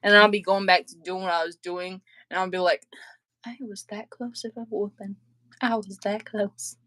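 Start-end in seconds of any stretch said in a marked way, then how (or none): 3.4-6.8 much softer, half to yourself; relieved under breath. Retold or imitated. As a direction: say it under your breath after a narrow escape. Relief after escaping a whooping.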